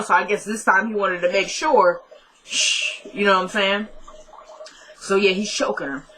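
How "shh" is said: unvoiced-sounding shush; hushing. Attacker silencing his victim, hushing. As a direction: cut her off with a shush.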